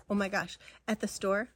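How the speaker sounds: ordinary talk, nothing marked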